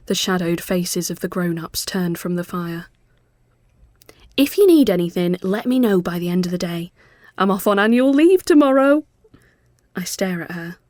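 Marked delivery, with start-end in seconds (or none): 4.4-9.0 chirpy Mel. A narrator's reading. Mel speaking cheerily.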